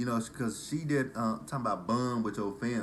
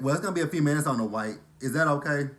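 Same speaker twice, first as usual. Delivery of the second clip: loud, fast, higher cashier voice; rude cashier; 6 semitones higher, 8 dB louder, faster than usual. Retold or imitated. Drive-thru girl warning of a wait, loud, fast and impatient. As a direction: rattle it off at her, impatient. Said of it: "She's like".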